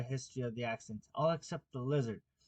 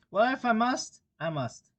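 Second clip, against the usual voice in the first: melancholy tone; 9 semitones higher, 8 dB louder than usual. A narrator's reading. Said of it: "with a melancholy tone".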